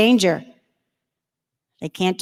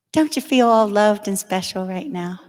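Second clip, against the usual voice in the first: usual speaking voice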